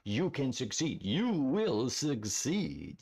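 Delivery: slow, big promise to the crowd; booming motivational speech. Pretend. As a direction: lay it on like a cheesy speaker. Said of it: "I sound like, like a motivational speaker".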